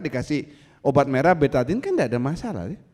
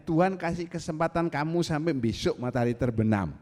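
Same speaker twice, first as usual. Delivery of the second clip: ordinary talk, nothing marked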